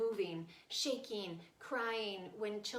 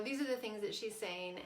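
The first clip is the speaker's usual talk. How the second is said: ordinary talk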